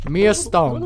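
ordinary talk, nothing marked

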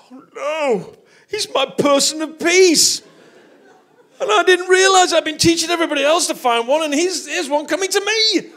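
high, hammed-up wail of realization; mock dismayed outcry. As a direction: cry out loudly, dragging it out in comic dismay. Acts out his dismayed realization.

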